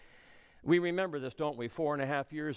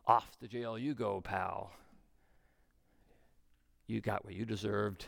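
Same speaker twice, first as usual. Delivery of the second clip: low, unforgiving acted sentence; cold dismissal; 7 semitones lower than usual. Slave sending his debtor to jail, coldly dismissive. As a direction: pass sentence in a lowered voice.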